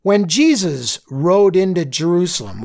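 ordinary talk, nothing marked

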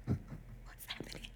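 whispered, slow and stunned; a frazzled mother whispering in disbelief; dazed whisper. Retold or imitated. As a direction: breathe it out, lost and overwhelmed. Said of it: "I was just like".

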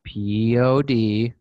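each letter drawn out slowly; slow spelled out. Real, not acted. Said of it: "spelling out handle".

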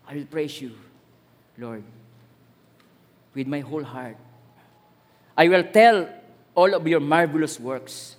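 slow, solemn reading of a psalm; scripture reading. Acted. Preacher reading a psalm to the congregation, solemn. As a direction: read the verse as a prayer. Reads psalm verse aloud.

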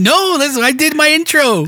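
high-pitched, laughing objection; indignant protest. Real, not acted. Protests being asked to redo intro.

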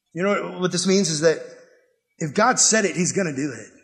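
ordinary talk, nothing marked

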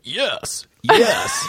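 high-pitched mock bark on words; translated dog bark. Comic translating dog barks into words, high mock yelp. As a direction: yelp the word out like an excited dog. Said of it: "dog barks translated are like".

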